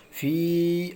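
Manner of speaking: فی with the ee held long on a steady note; long stretched madd. Shown as a demonstration. Teacher showing madd across two words, sustained فی. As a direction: draw out the vowel of فی. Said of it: "اس طریقے سے کھینچنا ہے".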